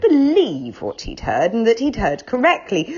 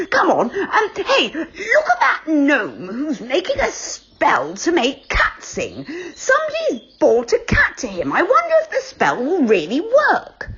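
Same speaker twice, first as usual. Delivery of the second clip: higher, eager storybook voice; Moonface; 7 semitones higher than usual. Acted. Moonface showing the others the cat-spell gnome, eager. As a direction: point and gasp at the gnome.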